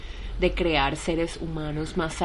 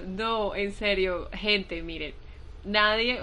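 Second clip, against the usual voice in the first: usual speaking voice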